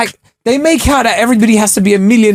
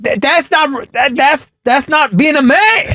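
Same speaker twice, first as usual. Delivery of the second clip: put-on higher, slow indignant American accent; higher incredulous American accent; Dame Dash impression; 8 semitones higher, slower than usual. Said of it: "That was Dame Dash".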